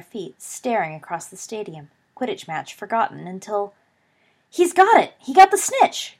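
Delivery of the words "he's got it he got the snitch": loud, high triumphant shout; excited cry. A narrator's reading. Hufflepuff fan crying out that their Seeker caught the snitch, loud and high. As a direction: yell it across the stadium in triumph. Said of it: "cried a voice".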